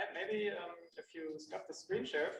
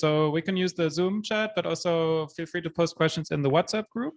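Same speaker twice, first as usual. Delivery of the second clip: louder, projecting into the room mic; speaking up; 10 dB louder than usual. Real, not acted. Host addressing the room audience, loud and projected. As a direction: project so the whole room hears. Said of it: "But now they can hear you".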